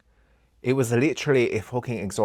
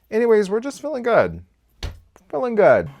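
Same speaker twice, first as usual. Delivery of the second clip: higher and louder, bright reset; upbeat and loud; 12 semitones higher, 7 dB louder than usual. Real, not acted. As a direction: bounce the mood back up. Cheerful change of topic.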